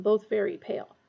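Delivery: plain reading voice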